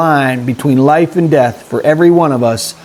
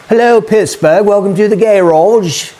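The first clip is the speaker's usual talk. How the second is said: lightly put-on English accent, a bit higher; playful hint of a posh English accent; 3 semitones higher than usual. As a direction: greet them in a proper English accent. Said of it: "And here comes my English accent".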